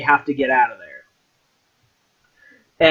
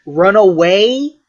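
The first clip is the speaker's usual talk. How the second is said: ordinary talk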